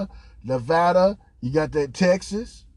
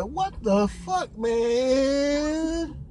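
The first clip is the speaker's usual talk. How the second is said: stunned disbelief; 8 semitones higher, 3 dB quieter, much slower than usual. Retold or imitated. Retelling his stunned reaction to TV.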